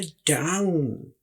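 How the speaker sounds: डाउन drawn out slowly with an open "aa" vowel; slow demo word. Shown as a demonstration. Teacher to students, slowly modelling डाउन. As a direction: stretch डाउन so the aa is heard. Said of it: "ओ को यहाँ पर आ की तरह बोलते हैं".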